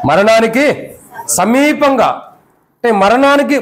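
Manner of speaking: drawn out, each word slow; slow stress. Shown as a demonstration. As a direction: dwell slowly on సమీపంగా. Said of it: "ఎలా ఉన్నాడండి?". (నెమ్మదిగా సాగదీసి)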